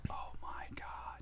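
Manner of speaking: breathless whisper of shock; whispered dread. Real, not acted. Him realising he is wanted, whispering in shock. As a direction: whisper it, stunned. Remembers his inner reaction.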